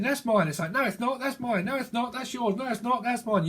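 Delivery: high, rapid back-and-forth bickering; high-pitched childish tit-for-tat; squabbling kids. Acted. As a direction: act out two kids fighting over a toy. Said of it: "that's two kids fighting over".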